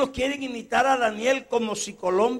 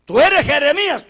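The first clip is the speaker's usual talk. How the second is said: demanding questioner; 7 semitones higher, 13 dB louder than usual. Acted. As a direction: press him for an answer. Questioner again.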